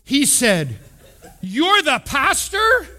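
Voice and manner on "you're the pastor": high, slow, incredulous question; astonished question. Retold or imitated. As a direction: mimic stunned disbelief, pitch shooting up. Shop owner's astonished reply, retold.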